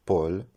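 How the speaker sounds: "Paul" as a mistaken poêle, with an open O; wrong pronunciation. Shown as a demonstration. Teacher rejecting a wrong way to say poêle, mispronounced as pol. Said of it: "Ce n'est ni".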